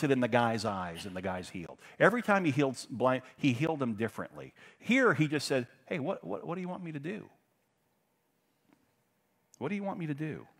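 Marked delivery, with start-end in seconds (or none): 5.9-10.5 softly asked, higher than usual; soft casual question. Acted. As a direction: ask it lightly and quietly, as Jesus. Acts Jesus casually asking.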